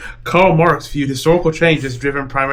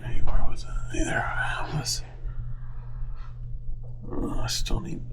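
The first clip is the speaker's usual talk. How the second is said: whispered to herself; breathy whisper while searching the board; 9 dB quieter than usual. Real, not acted. Game piece search, whispered.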